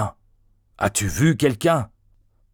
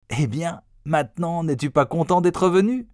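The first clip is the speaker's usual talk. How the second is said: gleeful teasing; 8 semitones higher than usual. A narrator's reading. Boys' gleeful nudges put into words.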